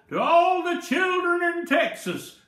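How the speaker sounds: loud, high Texas drawl, called out. A narrator's reading. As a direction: call back big in a Texas drawl. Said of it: "called back in his drawl".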